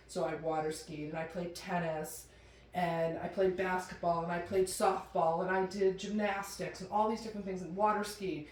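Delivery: ordinary talk, nothing marked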